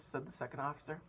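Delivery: usual speaking voice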